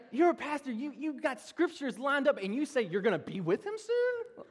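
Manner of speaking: high-pitched mock disbelief; imitated incredulous scolding. Wife scolding her pastor husband over his blunder, imitated as incredulous. Wife's appalled reaction, retold.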